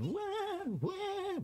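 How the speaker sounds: high falsetto wah wah crying; mock baby cry. Gamer mimicking the crying Sim, squeaky mock wail. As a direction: imitate a wailing baby, hammed up. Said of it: "Warum bist du denn zum Weinen?".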